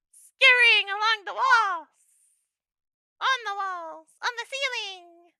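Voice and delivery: high, slow creepy gremlin. Pretend. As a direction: croak like a little nightmare creature. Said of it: "gremlin nightmare thing".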